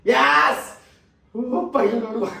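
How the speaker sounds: loud burst of a yes; celebrating shout. Real, not acted. Fan cheering a dot ball, loud. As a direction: punch it out as a cheer.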